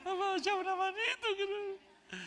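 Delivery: high, whiny mock-sobbing plea to mama; hammed-up crying kid begging his parents. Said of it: "en dos días más están llorando".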